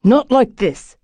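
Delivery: high and loud retort; shouted back. A narrator's reading. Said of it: "Rupert shouted back".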